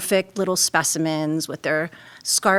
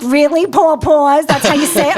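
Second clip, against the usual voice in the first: mocking classmates; 7 semitones higher, 7 dB louder than usual. Classmates making fun of her New York accent, mocking disbelief. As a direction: question "poor paws" like teasing classmates. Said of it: "Then they're like".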